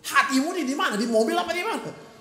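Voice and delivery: loud, high fervent confession; preaching at full force. Acted. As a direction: cry it out to God.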